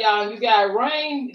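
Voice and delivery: ordinary talk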